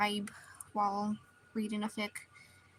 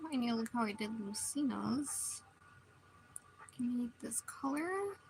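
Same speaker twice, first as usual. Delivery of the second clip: whispered to herself; whispering; 9 dB quieter than usual. Real, not acted. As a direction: murmur it under the breath. Whispering to herself while drawing.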